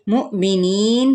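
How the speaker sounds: مؤمنین slow, with a short catch of the hamza on مؤ; jolt on mu. Shown as a demonstration. Teacher showing students the hamza jolt, slow recited. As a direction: give مؤمنین a little jerk on مؤ, drawn out slowly. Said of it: "مؤ پہ جھٹکا دیں گے".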